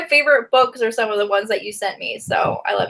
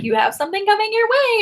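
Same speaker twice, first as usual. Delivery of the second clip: teasing announcement; 10 semitones higher, faster than usual. Retold or imitated. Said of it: "she's like".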